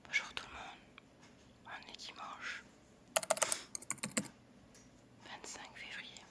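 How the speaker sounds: chuchotement tout bas, sans voix; chuchoté. Real, not acted. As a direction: murmure pour ne réveiller personne. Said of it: "Si je parle tout bas". (voix chuchotée très basse)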